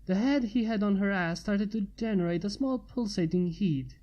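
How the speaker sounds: usual speaking voice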